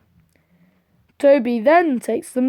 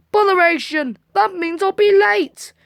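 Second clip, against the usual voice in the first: annoyed exclamation; 8 semitones higher, 3 dB louder than usual. Acted. Thomas annoyed.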